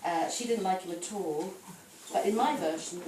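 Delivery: ordinary delivery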